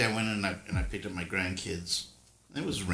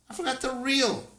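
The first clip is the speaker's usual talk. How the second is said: mock dismay. Grandpa voicing own retold line.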